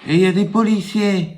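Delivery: put-on high little boy voice. Small boy telling caller everyone is busy, high child voice. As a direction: answer like a small child, matter-of-fact.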